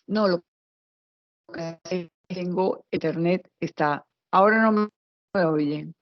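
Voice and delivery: breaking up over a bad connection; cutting in and out on a video call; choppy audio. Real, not acted. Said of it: "se escucha muy entrecortado".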